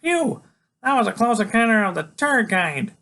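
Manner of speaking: high, loud kid voice of mock relief; high, punchy wisecrack; Freak. A narrator's reading. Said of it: "Freak goes".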